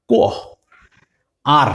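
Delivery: ordinary talk, nothing marked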